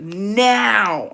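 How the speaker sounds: drawn-out demanding "Now" in a put-on voice; mock insistent command; imitated demand. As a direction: act out an impatient order, stretching the word. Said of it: "I swear I did this".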